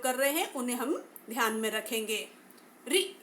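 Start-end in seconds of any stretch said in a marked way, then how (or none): 2.9-3.2 री with the tongue curled back; vowel demo. Shown as a demonstration. Teacher demonstrates retroflex री to students. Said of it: "री का उच्चारण करते समय".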